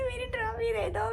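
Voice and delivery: high, soft sobbing mेरी trophy दे दो; sobbing plea. Retold or imitated. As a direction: beg for मेरी trophy through sobs. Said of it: "मैं बस रोती गई". (रोते हुए "मेरी ट्रॉफी दे दो")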